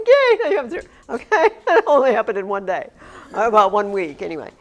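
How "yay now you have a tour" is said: high, loud celebratory yay breaking into laughter; mock cheer. Teacher recounting a tour mishap to class, mock cheer. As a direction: cheer it with a laugh, much higher and louder.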